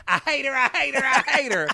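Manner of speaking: high quoting of hate her; imitating. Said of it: "you said in the first episode".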